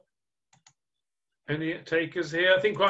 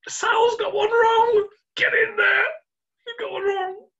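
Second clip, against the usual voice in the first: high-pitched triumphant yell; gleeful crowing; 4 dB louder than usual. Real, not acted. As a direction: crow over the star player's slip. Quizmaster celebrates Sal's wrong answer.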